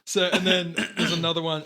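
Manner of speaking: usual speaking voice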